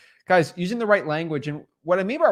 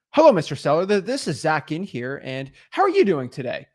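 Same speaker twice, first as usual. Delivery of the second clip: sales voice; 4 dB louder than usual. Shown as a demonstration. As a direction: greet them with a put-on salesperson's inflection. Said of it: "like a sales voice".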